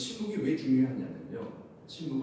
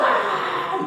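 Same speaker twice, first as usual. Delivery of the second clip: very high, loud shout; mock battle shout; 10 dB louder than usual. Preacher showing the Israelites' shout at Jericho, loud. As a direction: let out a huge crowd cry. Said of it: "이러는 거예요".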